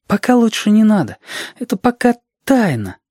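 put-on high, reluctant child voice; evasive child. Reshka keeping a secret from Alexander, high child voice. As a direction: hold back, in a high child's voice.

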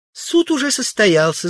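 very high female character voice; Тулия. A narrator's reading. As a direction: declare it in a high-pitched woman's voice. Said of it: "заявила Тулия". (заявляет высоким женским голосом)

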